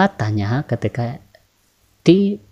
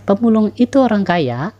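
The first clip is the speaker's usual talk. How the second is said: "Pemulung itu orang kaya" pitched high, as a yes-no question; rising question intonation; 9 semitones higher, 3 dB louder than usual. Shown as a demonstration. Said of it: "intonasi naik yang membutuhkan jawaban".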